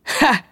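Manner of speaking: a sharp high burst of relieved laughter. Acted. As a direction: blurt out a single relieved ha. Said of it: "blew out a laugh of relief".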